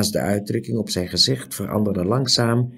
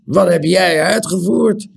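high, loud shocked question; shocked innkeeper; 9 semitones higher, 5 dB louder than usual. A narrator's reading. Shocked high cry. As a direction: innkeeper cries out at the ragged young man. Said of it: "riep de waard".

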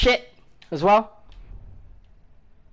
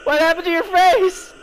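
high-pitched, loud astonishment; shocked exclamation; 10 semitones higher, 5 dB louder than usual. Real, not acted. Player shocked by a character's face, high exclamation.

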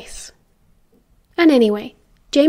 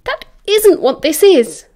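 slow, emphatic, raised objection; growled denial; 6 semitones higher, 5 dB louder than usual. A narrator's reading. Sirius denying his friend's accusation, tense and angry. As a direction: push back hard with gritted teeth. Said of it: "gritting his teeth and clenching his fists".

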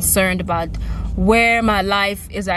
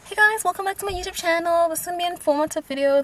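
high, quick mock YouTuber greeting; mock YouTuber intro; 8 semitones higher, 4 dB quieter, faster than usual. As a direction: parody a polished channel opening. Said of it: "Unlike all the other".

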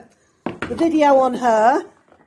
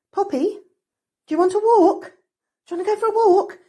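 high, louder sing-song to the dog; excited pet talk; 9 semitones higher, 3 dB louder, faster than usual. Real, not acted. Offering Poppy a walk, high excited pet voice. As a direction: rev the dog up with a bright high voice.

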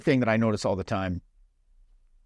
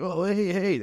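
much higher, jumpy "Oh, hey"; nervous eager greeting; 9 semitones higher, slower than usual. Retold or imitated. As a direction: sound unsure and too keen. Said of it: "it suggests lack of confidence".

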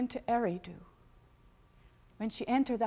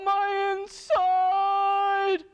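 higher, louder, very slow wail; queen of the underworld groaning on her throne, slow high wail; moaning cry; 9 semitones higher, 7 dB louder, much slower than usual. A narrator's reading. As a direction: draw the moan out in agony. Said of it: "She was moaning".

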